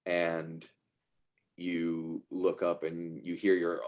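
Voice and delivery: ordinary talk, nothing marked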